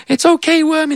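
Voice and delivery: high cooing to a worm; baby-talk the worm kindly; mock soothing. Comforting a worm before the hook, high cooing.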